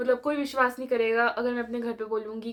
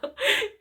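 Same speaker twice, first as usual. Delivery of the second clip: high giggle; 12 semitones higher than usual. Real, not acted. Laughs again.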